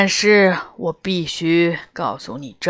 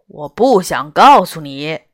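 curt; 4 semitones lower, 7 dB louder than usual. A narrator's reading. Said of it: "老虎不客气地说".

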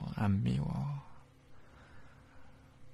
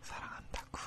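faint breathy whisper; 12 dB quieter than usual. Acted. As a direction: barely whisper it. Whispered love.

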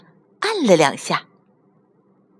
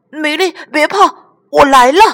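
raised voice building to a shout; rescuing call; 8 semitones higher, 7 dB louder than usual. Acted. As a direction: call out urgently to a fallen friend. Xiaohan calls to rescue fallen friend. (高声急切地喊着去救朋友)

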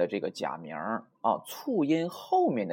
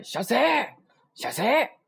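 high anime-style shop welcome call; izakaya staff greeting; 11 semitones higher, 4 dB louder, much slower than usual. Acted. Staff welcome as heard in cartoons, high-pitched call. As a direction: call out a squashed, casual irasshaimase. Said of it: "动画片儿也听过吧".

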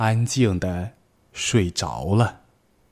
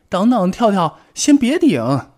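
much higher, louder alarmed cry; urgent warning; 11 semitones higher, 6 dB louder than usual. Acted. Bengbeng to Tiaotiao, urgently crying out a warning. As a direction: stop him before it's too late. Warning friend before shelf falls.

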